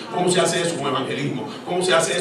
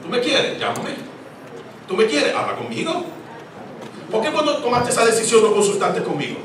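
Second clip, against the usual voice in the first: higher and louder, a hurt, demanding plea; God pleading and reproaching; 9 semitones higher, 4 dB louder than usual. Acted. The Lord confronting believers who never consult Him, raised reproach. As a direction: press each question like a wounded parent. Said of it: "El Señor dice".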